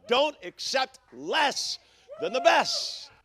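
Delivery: rising to a high shouted climax; preaching shout. Real, not acted. Pastor urging the church, shouting high. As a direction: drive it home to the congregation.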